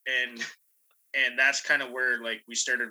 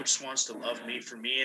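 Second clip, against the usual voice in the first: ordinary talk, nothing marked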